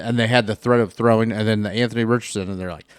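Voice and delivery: ordinary talk, nothing marked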